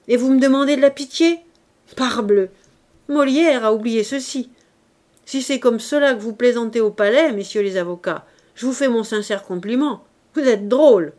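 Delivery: loud, scornful indignation; indignant, mocking outburst; outraged sarcasm. A narrator's reading. Outraged sarcasm at plea for pity.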